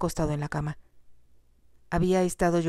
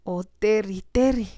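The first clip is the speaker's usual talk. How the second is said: grieving cry; 4 semitones higher, slower than usual. A narrator's reading. Jocelyn grieves for dying Terry, rising cry. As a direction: call his name in rising grief.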